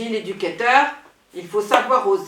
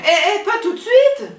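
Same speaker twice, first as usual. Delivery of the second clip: high, loud startled laugh-out; panicked giggling refusal; flustered laughing protest; 11 semitones higher, 6 dB louder than usual. Acted.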